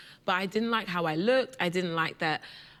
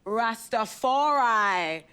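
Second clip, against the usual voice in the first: slow punchline; 4 dB louder, much slower than usual. Retold or imitated. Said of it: "What do you call a Rasta man".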